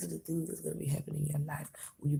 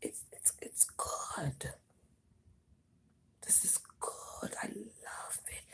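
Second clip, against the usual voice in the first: teary whisper; 2 semitones higher, 7 dB quieter, much slower than usual. Real, not acted. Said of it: "starting to choke up".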